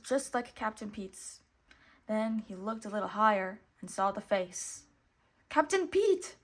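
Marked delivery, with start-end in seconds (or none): 5.5-6.4 very high, startled squeak of the name; squeaking weasel. A narrator's reading. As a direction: squeak it out in fright. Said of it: "he squeaked".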